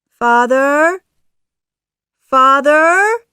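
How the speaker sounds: high, loud searching call. A narrator's reading. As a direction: call for someone lost, rising at the end. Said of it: "calls Pinocchio".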